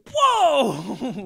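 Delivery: high, loud startled gasp of a word; body shocked by cold water, sudden whoa. Retold or imitated. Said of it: "your body just goes".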